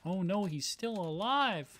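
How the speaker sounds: high-pitched mock dismay; mimicked clip line. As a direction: imitate an alarmed character from the clip. Echoing the played clip's line.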